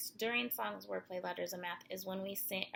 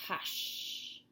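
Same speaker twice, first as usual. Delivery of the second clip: hushed. Acted. Reading a lullaby, a whispered hush. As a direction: breathe the word out gently.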